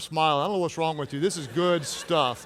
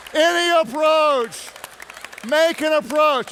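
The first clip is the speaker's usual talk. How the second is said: high, emphatic preacher's proclamation; full-force preaching; 7 semitones higher, 3 dB louder, slower than usual. Real, not acted. Preacher advises husbands then wives on approach, full-force preaching. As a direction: proclaim it, stretching key words.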